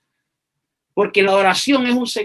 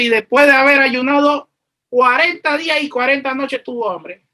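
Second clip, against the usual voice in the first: raised, emphatic scripture reading; verse read aloud; 4 semitones higher, 3 dB louder than usual. Real, not acted. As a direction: proclaim the verse to the congregation. Reads the verse out in earnest.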